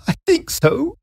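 high, tearful sob; sobbing. A narrator's reading. Wife telling the police, high sobbing voice. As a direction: choke it out through tears. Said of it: "she sobbed".

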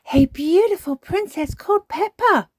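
put-on high, loud little-girl voice; interrupting. A narrator's reading. Peppa interrupting Granny's bedtime story, excited. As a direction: butt in excitedly like a child. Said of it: "interrupted Peppa".

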